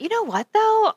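high, struck by an idea; sudden thought. Retold or imitated. Friend recalling her thought when texting the husband, high and struck. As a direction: pitch way up as it dawns. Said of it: "I thought".

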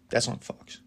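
soft whispered emphatic praise; whispered aside. Real, not acted. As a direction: lean in and whisper it like a secret. Hushed aside praising a song.

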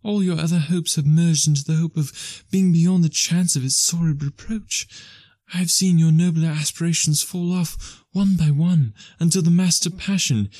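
put-on a fair young girl letting her lover go; young woman's voice. A narrator's reading. Belle, young woman voiced high.

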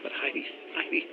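breathless, barely voiced disbelief; stunned whisper. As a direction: whisper it in shock. Shocked by the betrayal.

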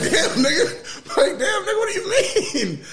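high, laughing stunned disbelief; laughing shock. Retold or imitated. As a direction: squeal it out in disbelief.

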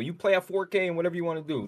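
ordinary delivery

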